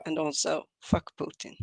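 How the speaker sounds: hushed, breathless jab; whispered aside. Real, not acted. After a mock apology for Russia, whispering the curse at Putin. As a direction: drop to a whisper for the punchline.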